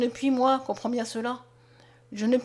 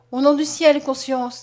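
exclamation; 3 semitones higher, 7 dB louder than usual. A narrator's reading. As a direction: cry out, begging him to stop. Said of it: "s'écria Mariette".

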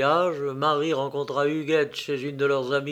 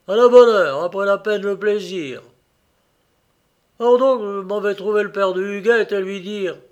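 loud, higher exclamation settling into brisk resolve; hearty approval; 4 semitones higher, 8 dB louder than usual. A narrator's reading. Father welcomes his son's consent, heartily loud. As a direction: burst out loudly, then announce the plan.

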